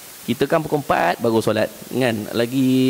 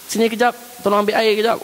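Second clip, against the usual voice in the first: put-on higher call to the child for water; parent calling; 9 semitones higher than usual. Vater ruft Kind.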